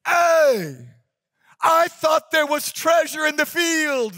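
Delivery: high, loud, outraged cry; outraged shout. Acted. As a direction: cry out in bitter disappointment. Angry younger brother's outcry.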